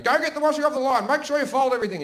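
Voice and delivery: high, loud mum's yell; bossy shouted impression of mum; imitated yelling. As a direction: mimic mum yelling orders from indoors. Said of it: "yelling at us".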